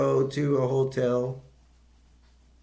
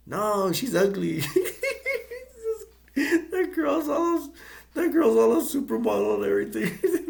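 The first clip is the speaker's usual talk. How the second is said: laughing through the words, pitched high; cracking up mid-sentence; giggling while telling the joke; 4 dB louder than usual. Real, not acted. Laughing through retold answer.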